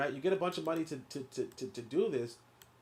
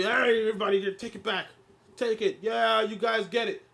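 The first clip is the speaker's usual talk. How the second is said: mock giveaway; 6 semitones higher, 5 dB louder than usual. Mock generous giving back money.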